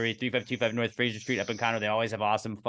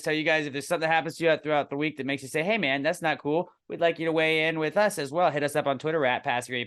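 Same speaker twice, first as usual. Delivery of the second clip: high, loud host announcement; segment host; 7 semitones higher, 5 dB louder than usual. Acted. Host opening the listener segment, high upbeat announcer voice. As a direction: pump up the segment opener.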